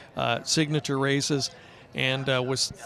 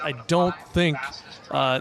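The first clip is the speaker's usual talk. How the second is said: normal talking voice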